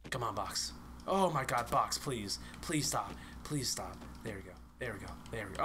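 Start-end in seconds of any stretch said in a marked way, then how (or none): none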